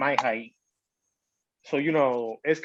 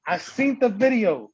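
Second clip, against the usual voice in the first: high, drawn-out mock accusation; accusing quote; 7 semitones higher, 4 dB louder, slower than usual. Retelling the sister confronting him, drawn-out accusation. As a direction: imitate her calling him out, slowly.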